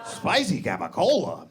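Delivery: mock thrilled old man repeating it; excitable outburst. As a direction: lose your mind over deli meat. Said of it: "he is a very excitable man".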